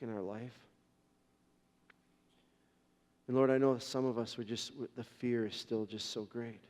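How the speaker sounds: low, soft prayer voice; closing prayer. Real, not acted. Pastor thanking God for the congregation, low soft prayer. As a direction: speak gently to God, hushed at the start and end.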